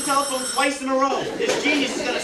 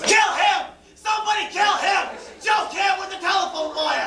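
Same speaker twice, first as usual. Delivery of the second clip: high, enraged yelling; exploding with exasperation; furious shout; 4 semitones higher than usual. Acted. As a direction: call out for help in a comic rage. Enraged at man on telephone.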